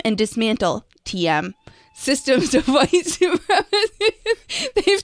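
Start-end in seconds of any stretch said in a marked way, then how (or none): 1.9-5.0 laughing while reading. Real, not acted.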